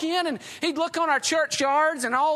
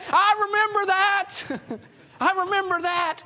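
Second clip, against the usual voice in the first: excited; 5 semitones higher than usual. Acted. Moody suddenly excited, remembers scripture.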